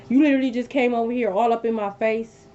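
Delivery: ordinary delivery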